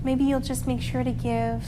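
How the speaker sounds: soft, calm meditation voice; calming guided relaxation. Acted. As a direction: guide the kids into rest gently.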